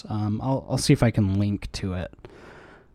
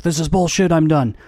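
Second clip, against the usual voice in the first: frustrated outburst; 6 semitones higher, 5 dB louder than usual. Retold or imitated. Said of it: "they're like".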